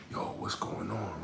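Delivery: whispered and unvoiced; hushed whisper. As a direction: breathe it like a secret. Friend wondering, whispered.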